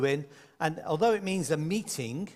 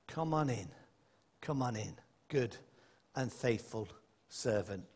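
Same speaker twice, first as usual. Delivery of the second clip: gentle welcome; 7 semitones lower, 7 dB quieter than usual. Retold or imitated. God welcoming the believer.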